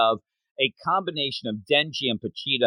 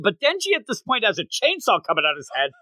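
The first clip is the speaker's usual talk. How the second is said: ordinary talk, nothing marked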